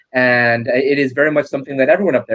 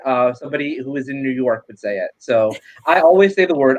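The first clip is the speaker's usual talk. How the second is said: ordinary talk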